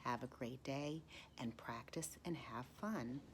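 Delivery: hushed closing words; whispered farewell. Acted. Teacher bidding students goodbye, soft whisper. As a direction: send them off gently in a whisper.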